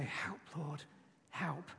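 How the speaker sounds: breathy whisper of a plea; whispered prayer. Shown as a demonstration. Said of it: "Train yourself to say".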